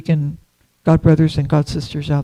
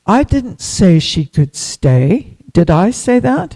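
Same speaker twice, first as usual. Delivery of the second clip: retold angry rebuke; 2 semitones higher, 6 dB louder, slower than usual.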